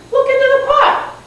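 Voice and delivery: put-on very high old lady voice; little old lady. Old lady pointing a girl to the pot, high voice. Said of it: "And she said".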